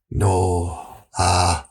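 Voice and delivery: deep, slow shady voice; low dodgy dealer. Acted.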